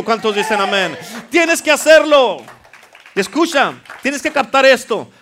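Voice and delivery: impassioned preaching. Real, not acted. Preacher urging the congregation to speak to their mountains, impassioned. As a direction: pile up phrases with rising urgency.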